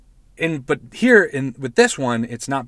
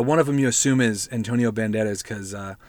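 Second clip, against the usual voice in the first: usual speaking voice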